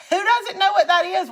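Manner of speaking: high, loud disbelief; can't believe he doesn't know; incredulous. Retold or imitated. Retelling her shocked reply.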